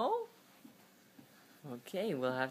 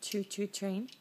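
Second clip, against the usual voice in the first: usual speaking voice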